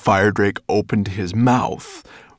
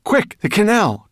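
high, loud hurried call; urgent cry; 8 dB louder than usual. Acted. Boy crying out urgently to flee by the canal. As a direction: cry out to get them moving. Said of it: "cried Ben".

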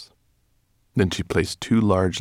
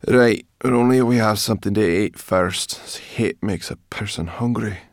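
lightly voiced brownie, raised and loud; grumbling brownie; 5 semitones higher, 7 dB louder than usual. A narrator's reading. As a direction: grumble about being hungry in a character voice. Sleepy brownie grumbles about food.

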